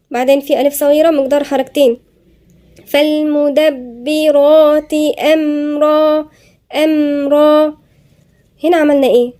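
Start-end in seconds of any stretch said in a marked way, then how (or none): 2.9-7.8 فالمدبرات with a doubled ba, أمرا drawn out; tajweed breakdown; slow demo. Shown as a demonstration. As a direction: chant فالمدبرات أمرا slowly. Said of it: "الباء هنا مشددة".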